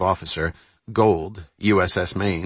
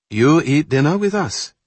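put-on Korean accent; 8 semitones higher, 5 dB louder than usual.

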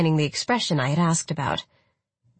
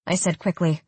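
ordinary delivery, nothing marked

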